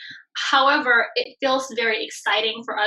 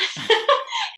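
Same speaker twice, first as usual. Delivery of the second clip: laugh. Real, not acted. Laughing at the memory.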